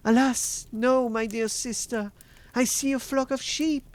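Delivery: high-pitched sister with bad news; dismayed Sister Anne. A narrator's reading. Sister Anne telling her sister no rescue yet, high dismayed voice. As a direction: answer regretfully in a high voice.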